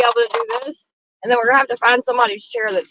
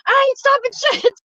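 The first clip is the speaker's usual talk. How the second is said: retold yelling back; 13 semitones higher than usual. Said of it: "I said".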